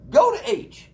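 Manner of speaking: high loud defiant yell; shout back at doubt. Pretend. Teacher shouting down negative self-talk, high and loud. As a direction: tell the voice off hard. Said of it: "so you're like".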